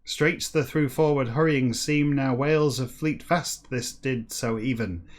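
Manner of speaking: slow, deliberate read-aloud; story narration. Acted. Reader reciting a backwards whale novel to listeners, measured narration. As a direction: read each phrase out with measured emphasis.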